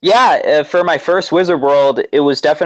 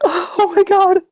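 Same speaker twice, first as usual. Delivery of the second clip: squeals it very high; a starstruck squeal, way up high; slower than usual. Retold or imitated. Fan meeting her idol, squealing very high. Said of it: "I was like".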